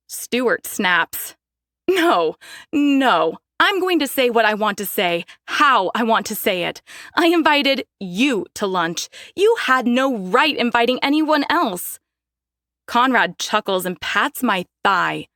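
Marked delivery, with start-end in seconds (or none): 1.9-12.0 raised, higher, insistent protest; angry. Acted. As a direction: stand your ground, voice rising. Daphne confronting her parents.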